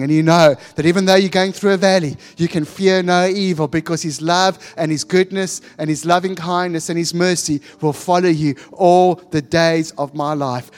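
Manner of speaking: rousing preaching. Real, not acted. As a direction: preach it with building conviction, lifted and forceful.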